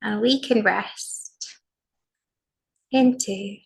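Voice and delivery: slow, spacious meditation voice with long pauses; guided meditation. Acted. Teacher guiding a sitting practice, slow and soothing. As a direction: guide the group into calm, one phrase at a time.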